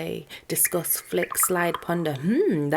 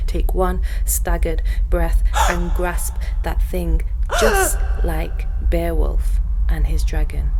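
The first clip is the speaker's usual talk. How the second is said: louder and slower, with gasps between words; intense building climax; 6 dB louder, slower than usual. Acted. Poet tears out the heart in a slow loud gasping climax. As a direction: drive it home with ragged, gasping force.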